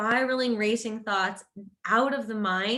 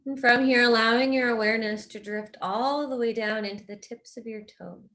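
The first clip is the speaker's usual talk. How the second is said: guided meditation. Real, not acted. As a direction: guide them gently into rest, unhurried and even.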